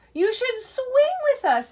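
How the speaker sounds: high playful monkey voice. A narrator's reading. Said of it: "said the monkeys".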